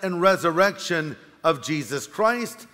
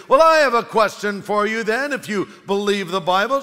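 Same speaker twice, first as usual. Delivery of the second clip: booming atheist; 3 semitones higher, 4 dB louder than usual. Acted. Atheist voiced in story.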